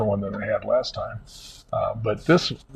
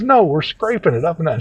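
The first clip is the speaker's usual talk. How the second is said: lightly imitated assistant, higher pitch; retold correction in a raised voice; assistant correcting; 6 semitones higher, 4 dB louder than usual. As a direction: correct him firmly, pitched up. Assistant corrects him in retelling.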